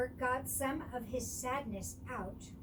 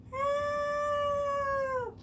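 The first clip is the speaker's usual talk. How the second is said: very high, loud, long call; cry for help; 14 semitones higher, 7 dB louder than usual. A narrator's reading. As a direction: call out from far away.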